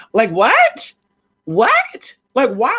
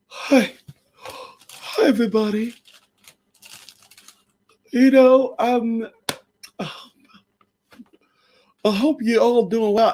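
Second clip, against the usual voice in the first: dopey YouTuber greeting; 3 semitones higher than usual. Retold or imitated. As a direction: lightly drag out a stoned vlogger's greeting. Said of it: "they were just like".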